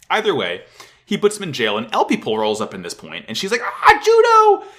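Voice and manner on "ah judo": high, loud delighted squeal; hammed-up, drawn-out happy shriek; overjoyed greeting. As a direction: squeal the name on spotting a favorite person. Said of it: "she's so happy to see him".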